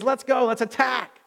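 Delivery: rally them to attack; rallying battle call. Acted.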